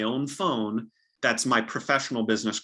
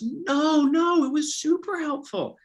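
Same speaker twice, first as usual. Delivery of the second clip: much higher, put-on eager reassurance; polite Minnesotan reaction; slower than usual. Buyers politely reassuring the agent, high and eager. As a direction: squeak out a polite Minnesota-nice denial. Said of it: "the common reaction is".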